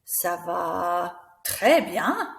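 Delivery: "très" swooping up, slowly. Shown as a demonstration. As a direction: stretch "Ça va" and lift "très bien". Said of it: "using a different voice".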